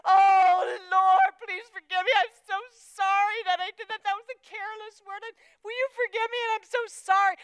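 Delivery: loud, high, pleading mock prayer. Mock tearful prayer of apology.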